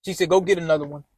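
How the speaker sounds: low, soft, offhand mother; lightly voiced mother moving on; mother telling her son to find another woman, low and offhand; mom's voice. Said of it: "And she's like".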